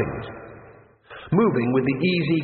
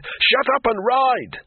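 much higher, slow urgent yell; shouting; 11 semitones higher, much slower than usual. Acted. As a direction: bark the order in panic. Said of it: "shouted Rincewind".

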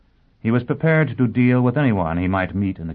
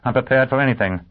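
firm warning; 4 semitones higher, faster than usual. A narrator's reading. Gunman repeats his warning.

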